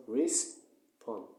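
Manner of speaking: "respon" slowly split as res - pon; slow "respon" with the s closing the first syllable; wrong syllable division. Shown as a demonstration. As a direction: break "respon" after the s. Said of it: "but this is wrong".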